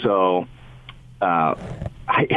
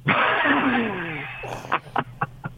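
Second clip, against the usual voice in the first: high laugh trailing off softly; real high-pitched laugh, fading away; 11 semitones higher, 5 dB quieter than usual. Real, not acted. Guest laughing at co-host's "We tried not to", amused high laugh. Laughing at the joke about avoiding Arizona game.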